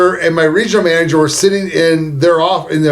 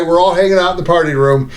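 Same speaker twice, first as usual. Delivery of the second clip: usual speaking voice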